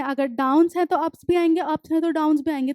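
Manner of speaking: ordinary talk, nothing marked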